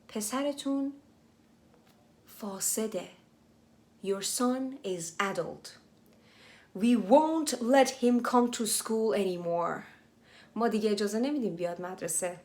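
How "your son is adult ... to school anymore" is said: slow reading. Shown as a demonstration. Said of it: "slow English reread for learners".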